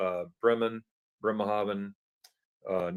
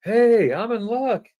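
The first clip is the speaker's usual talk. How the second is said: quoting friend; 12 semitones higher, 8 dB louder, much slower than usual. Retold or imitated. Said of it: "he goes".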